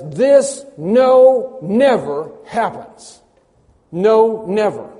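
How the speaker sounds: "no" and "never" high, loud and slow; each "no" and "never" struck hard and high; emphatic divine declaration. Acted. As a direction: thunder "no, never" word by word. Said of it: "indicating that God says".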